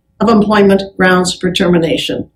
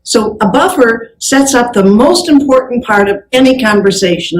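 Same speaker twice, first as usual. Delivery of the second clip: normal talking voice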